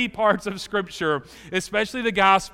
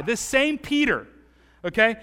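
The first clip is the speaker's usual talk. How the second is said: usual speaking voice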